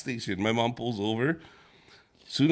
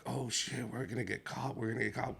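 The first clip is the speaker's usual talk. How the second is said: soft fearful muttering; scared whisper; 2 semitones lower, 9 dB quieter than usual. Retold or imitated. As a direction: worry under breath. Mom whispering in fear.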